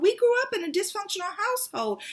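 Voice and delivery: thrilled declaration to her sister; excited. Retold or imitated. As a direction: announce it like wonderful news. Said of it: "I'm just so excited".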